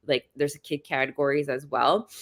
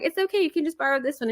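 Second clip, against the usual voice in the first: high, calm reassurance; retold calm voice, pitched up; reassuring; 12 semitones higher, faster than usual. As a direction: soothe a stressed friend lightly. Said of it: "And I was like".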